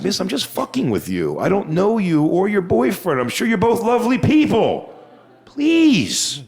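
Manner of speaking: high, exaggerated pleading to the heckler; overly sweet begging with a sting; mock pleading.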